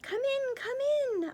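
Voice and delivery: high voice calling from a distance; distant welcoming call; faraway voice. Acted. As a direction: call it out from far away. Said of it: "a faraway voice called".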